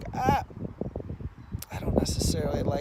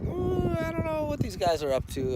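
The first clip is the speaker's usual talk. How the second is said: suspicious ooh; 14 semitones higher than usual. Retold or imitated. Suspicious inner voice.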